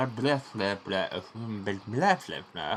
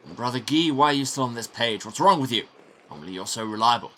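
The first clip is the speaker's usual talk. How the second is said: a touch louder, exasperated prior; irritated prior; 3 semitones higher, 7 dB louder than usual. Acted. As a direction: mildly scold the usually reliable brother. Prior scolds Brother Guy.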